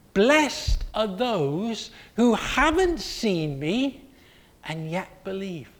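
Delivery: slow, deliberate blessing; Jesus blessing future believers; solemn blessing. Acted. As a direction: give it weight, slowly.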